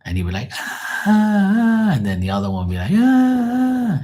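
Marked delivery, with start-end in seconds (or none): 0.5-1.9 long, very high, loud imitated shriek; mock cartoon scream. Cartoon character being chased, mock high scream. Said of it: "all they do is scream and shout". 2.9-4.0 very high, loud, sustained imitated shriek; mock cartoon scream. Other cartoon character joining the chase, mock high scream. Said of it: "five minutes of fucking screaming".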